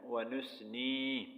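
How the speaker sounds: व नुस्नी slow, clear नी; व नुस्नी, ni distinct; correct nun kasra. Shown as a demonstration. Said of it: "नून या ज़ेर नी पढ़ना है".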